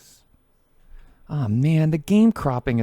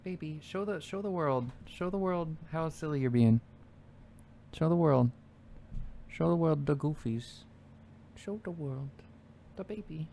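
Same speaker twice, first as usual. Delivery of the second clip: put-on baby talk to cat; 8 dB quieter than usual.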